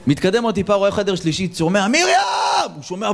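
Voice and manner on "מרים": high, drawn-out scream of the name; madman's scream. Acted. Married man in the asylum screaming for Miriam, high yell.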